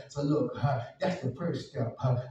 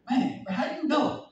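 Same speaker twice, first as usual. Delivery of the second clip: surprised question; 12 semitones higher, 6 dB louder than usual. Retold or imitated. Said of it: "and say".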